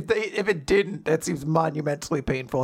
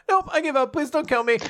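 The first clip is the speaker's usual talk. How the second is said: cowardly squeak; 11 semitones higher, 4 dB louder than usual. Retold or imitated. Cowardly surrender to the guards.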